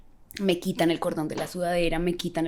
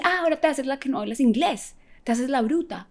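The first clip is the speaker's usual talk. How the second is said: higher, sneering accusation; mock accusing officer; 8 semitones higher than usual. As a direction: jab at her like a suspicious officer. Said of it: "y ella toda".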